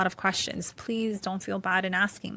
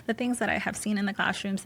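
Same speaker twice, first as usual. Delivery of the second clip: ordinary delivery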